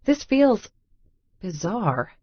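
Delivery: usual speaking voice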